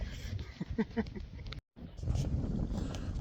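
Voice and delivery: long chuckle at own joke; real, drawn-out laugh. Real, not acted. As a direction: giggle on helplessly after the punchline.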